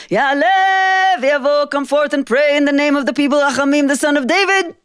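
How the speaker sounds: calling out. Acted. The rabbi summoning Rachamim before the congregation, calling out. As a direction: call him forth before the whole congregation. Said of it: "the rabbi stands and calls out".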